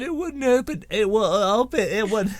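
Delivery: playful put-on English accent. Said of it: "that's my English accent".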